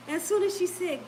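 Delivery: ordinary talk, nothing marked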